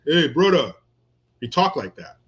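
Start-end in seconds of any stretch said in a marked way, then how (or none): 0.1-0.7 put-on light Tongan accent, "brother" said as "brotha"; a hint of the coworker's Tongan accent. Greeting like Lepetti the coworker, light Tongan accent. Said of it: "so he had that accent".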